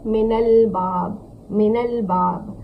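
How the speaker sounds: "मिनल बाब" very slow, with "a" linking min to al; joined Arabic reading. Shown as a demonstration. Teacher repeating for students after explaining the zabar, slow joined "मिनल बाब". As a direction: stretch "मिनल", then land on "बाब". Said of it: "इसको हम ज़बर देंगे".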